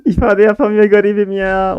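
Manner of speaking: hammed-up tearful lament; mock crying. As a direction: pretend to weep for the poor guy. Mock crying over opponent.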